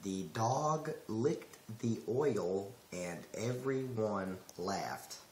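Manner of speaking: slow, choppy, careful neutral diction; measured, stiff speech with the accent held back; accentless, attempted smooth. Shown as a demonstration. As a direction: try for smooth but come out word by word. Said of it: "Can you say it smoothly?".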